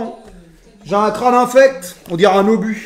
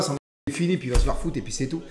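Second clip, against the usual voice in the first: ordinary talk, nothing marked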